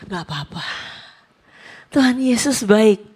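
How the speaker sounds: mock pious calm. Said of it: "respon saya gimana".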